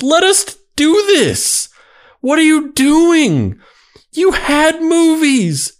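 dramatic reading. Acted. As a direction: ham it up like a wounded actor. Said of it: "And scene. And that was a dramatic reading".

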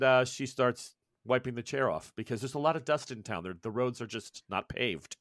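ordinary delivery

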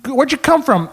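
very high, loud questioning; eager caller imitation. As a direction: imitate excited old couple prying. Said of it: "They're asking me all this shit".

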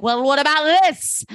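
high, rising mock confrontation; hammed-up challenge; dramatic reveal. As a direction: throw it out like a gotcha. Acted reveal in a recap.

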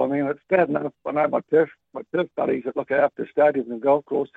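usual speaking voice

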